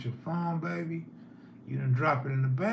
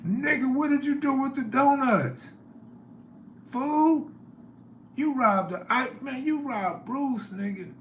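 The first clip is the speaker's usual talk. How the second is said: mock outraged yell; 11 semitones higher than usual. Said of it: "I'm like".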